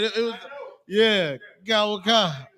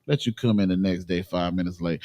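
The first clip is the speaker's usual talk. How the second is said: ordinary talk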